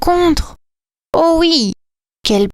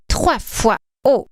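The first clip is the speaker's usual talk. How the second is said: ordinary delivery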